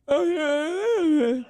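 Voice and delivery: overwhelmed fan squealing yes while crying; tearful squeal. Retold or imitated. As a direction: squeak out the yeses through happy tears. Overjoyed fan accepts the offer.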